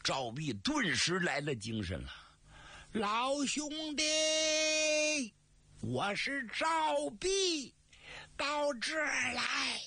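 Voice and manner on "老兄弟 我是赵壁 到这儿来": high, slow drawn-out call across the distance. Acted. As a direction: stretch each word out and call to him from far off. Calling out to his old friend. (高声拖长呼喊)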